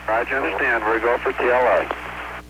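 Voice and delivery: astronaut radio. Real, not acted. Crew answers over radio.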